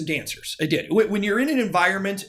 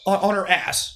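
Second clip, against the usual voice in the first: usual speaking voice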